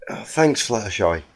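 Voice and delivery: usual speaking voice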